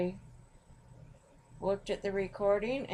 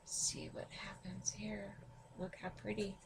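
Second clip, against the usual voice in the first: soft, hushed wonder; whispering; 12 dB quieter than usual. Real, not acted. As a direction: whisper it while watching closely. Quiet while pouring granules.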